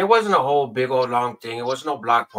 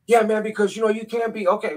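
officious demand; 10 semitones higher than usual. Retold or imitated. Drunk neighbor confronting the narrator in the fog, pompous high shout. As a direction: puff yourself up like a self-appointed lawman. Neighbor lecturing about fireworks.

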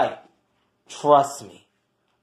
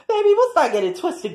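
sassy taunt; 11 semitones higher, faster than usual. Acted. Continues taunting the roommate.

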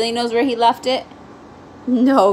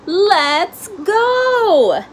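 calling out; 9 semitones higher, 5 dB louder than usual. A narrator's reading. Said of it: "called his father".

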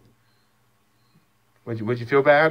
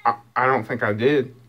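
ordinary delivery

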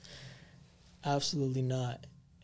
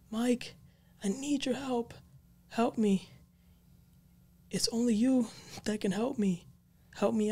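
high, desperate plea for help, voice pitched up; desperate pleading; 9 semitones higher than usual. Retold or imitated. Friend calling Mike from hell, pleading high and desperate. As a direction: beg like someone trapped and out of time. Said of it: "he's pleading".

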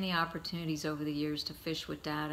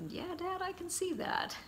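much higher, dry teasing reply; 14 semitones higher than usual. Retold or imitated. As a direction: rib him gently. Teasing his stuck father.

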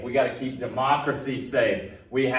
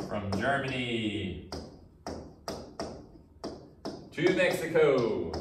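slow dictation; 5 dB quieter, slower than usual. Shown as a demonstration. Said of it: "dictating notes".

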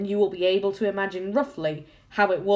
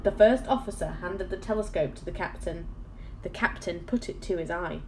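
ordinary delivery

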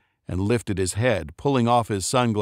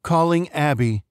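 higher, bright robotic announcement; phone assistant voice; 6 semitones higher than usual. A narrator's reading. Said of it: "the phone said".

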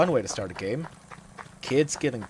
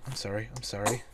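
ordinary talk, nothing marked

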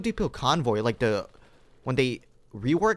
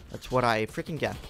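usual speaking voice

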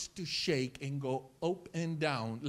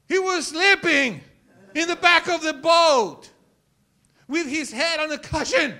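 loud, high exclaiming in short bursts; emphatic preacher's yell, pausing between phrases; incredulous shout; 10 semitones higher, 13 dB louder than usual. Real, not acted. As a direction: shout it to the congregation in disbelief. Preacher exclaims at the absurd detail.

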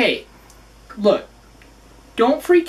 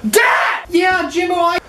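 yelling; 7 semitones higher, 3 dB louder than usual. Acted. Teen yelling at dad.

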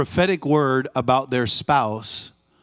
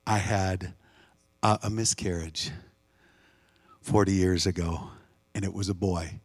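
hushed confession; 8 semitones lower, 8 dB quieter than usual. Retold or imitated. The mother telling the minister of her loss, hushed and low. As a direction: tell it quietly, as if it still hurts.

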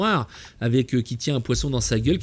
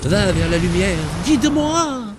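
mock worship. Mock prayer to the moth man statue.

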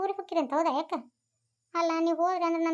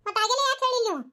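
higher, louder accusing question; indignant demand; 7 semitones higher, 3 dB louder, faster than usual. Acted. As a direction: demand why nobody told her. Confronting the grandmother over the lie.

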